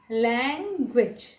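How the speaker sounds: "लैंग्वेज" slowly in two parts, lang-gwich; short gwich ending on a slow "लैंग्वेज"; correct pronunciation. Shown as a demonstration. Teacher showing learners "लैंग्वेज" slowly, ending in short gwich. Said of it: "छोटा करके pronounce करना पड़ेगा".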